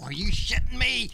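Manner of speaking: loud incredulous outburst; outraged. Real, not acted. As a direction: throw it back in disbelief. Repeated outraged question.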